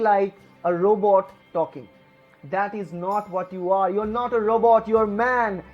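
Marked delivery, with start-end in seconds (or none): none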